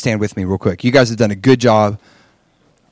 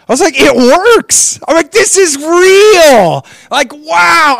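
high, loud, joyful burst; overjoyed; 10 dB louder, much slower than usual. Retold or imitated. Preacher reliving his amazement to the congregation, high and loud. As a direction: shout it out in sudden delight. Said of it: "I was so happy".

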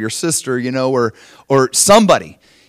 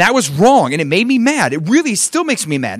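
higher, heated mock complaint; accusing bad apology; 6 semitones higher than usual. Shown as a demonstration. Husband apologizing to wife then blaming her, mock-indignant. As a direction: let the apology build into lively accusation. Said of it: "here's how you don't do it".

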